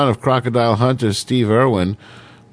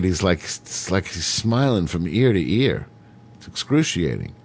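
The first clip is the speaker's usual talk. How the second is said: ordinary delivery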